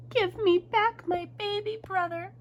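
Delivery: high, slow, emphatic demand; storybook child standing up to a gorilla; demanding little girl. A narrator's reading. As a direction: insist on each word in a high voice.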